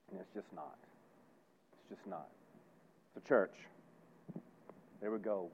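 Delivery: very soft and low; hushed conclusion. Real, not acted. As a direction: drop right down to a sober near-murmur. Sober letdown after listing false fixes.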